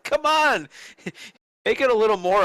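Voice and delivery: high-pitched incredulous protest; exasperated. Real, not acted. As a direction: squeal it in amused disbelief. Mock exasperation at slow stops.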